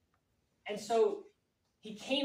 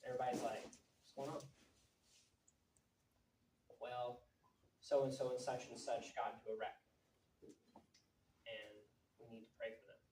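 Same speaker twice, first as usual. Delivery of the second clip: much softer, low grave voice; showing how serious news is told; serious hush; 8 semitones lower, 12 dB quieter than usual. Shown as a demonstration. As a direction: drop it to a solemn hush. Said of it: "the way that they say things".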